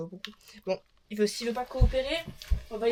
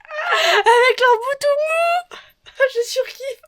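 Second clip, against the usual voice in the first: high, loud delighted squeal; excited squeal; 9 dB louder, much slower than usual. Real, not acted. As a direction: squeal with joy over it. Squealing over soft applicator.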